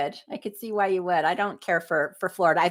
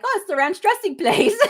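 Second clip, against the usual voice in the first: laughing; 9 semitones higher, 3 dB louder than usual. Retold or imitated. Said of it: "I'm like".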